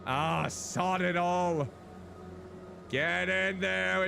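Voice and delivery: slow, high exasperated cursing; frustrated cursing. Real, not acted. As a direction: drag it out in exasperation. Piece still won't fit.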